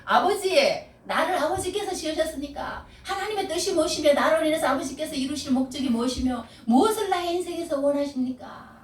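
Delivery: a lightly voiced earnest prayer, set apart from his preaching; humble prayer. Shown as a demonstration. Preacher models how to pray before God, earnest. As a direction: pray it sincerely as an example. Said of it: "하고 하나님 앞에 침묵하면서".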